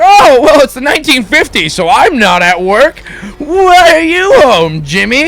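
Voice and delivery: high, loud fussy mom; shrill-pitched sitcom mom. Acted. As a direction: squeal it like a flustered mother. Mom voiced in shaggy dog story.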